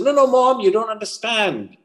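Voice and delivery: high-pitched teenage protest, acted; pleading son. Son begging his mother for the car, high-pitched acted pleading.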